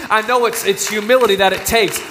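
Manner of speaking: ordinary delivery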